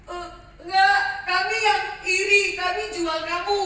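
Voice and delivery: loud, high, laughing nervously; frantic confession. Acted. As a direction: blurt out the guilt. Brothers confess guiltily.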